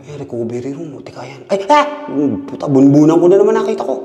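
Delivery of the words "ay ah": sudden high scream of fright; real jump-scare shriek; startled scream. Real, not acted.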